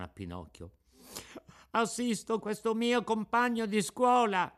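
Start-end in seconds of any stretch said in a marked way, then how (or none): 1.7-4.5 high, timid reply; a small boy answering the police; frightened puppet. A narrator's reading. Pinocchio answers the carabinieri, high and timid.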